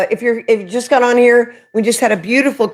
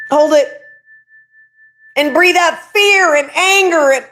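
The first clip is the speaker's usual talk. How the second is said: lightly voiced, higher guided breathing; 5 semitones higher than usual. To her live viewers, a higher guided breathing voice.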